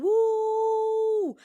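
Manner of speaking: high, loud cheering woo; excited woo. Real, not acted. Hyping the audience at the intro.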